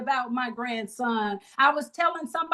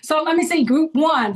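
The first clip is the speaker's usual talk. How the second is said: louder, calling the group to answer; 3 semitones higher, 7 dB louder than usual. Real, not acted. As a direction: call out to the whole room. Calling out to the room.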